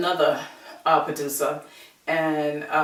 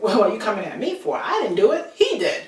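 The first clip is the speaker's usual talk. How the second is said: rising, higher-pitched protest of innocence; indignant retelling; 3 semitones higher, 4 dB louder than usual. Retold or imitated. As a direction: push the blame away, pitch climbing. Retold protest at being blamed.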